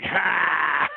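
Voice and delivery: loud, sudden barked ha. Acted. Game master acts out the player's reply, loud ha. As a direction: shout a single punchy ha. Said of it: "And you're like".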